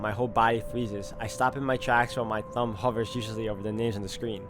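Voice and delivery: usual speaking voice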